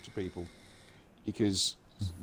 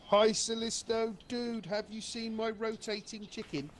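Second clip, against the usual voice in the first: high, slow goofy greeting; squeaky playful hello; silly high voice; 10 semitones higher than usual. Pretend.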